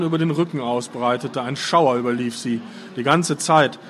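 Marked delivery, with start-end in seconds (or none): none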